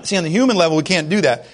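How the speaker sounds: usual speaking voice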